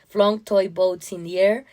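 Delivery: ordinary delivery, nothing marked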